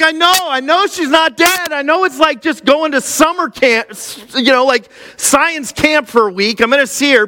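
a dad insisting he's fine while falling apart; emotional protest. Retold or imitated. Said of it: "I'm like".

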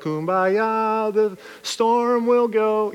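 mock the passive wait-it-out attitude; slow drawn-out mocking Kumbaya. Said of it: "just be like".

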